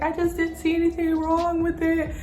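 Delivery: high, pleading defence; imitated whiny excuse. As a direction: mimic a friend's whiny excuse. Friend's acted excuse, repeated.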